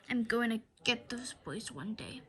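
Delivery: low, soft menacing whisper. Acted. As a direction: whisper the threat like a villain. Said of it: "Mister Krupp vowed".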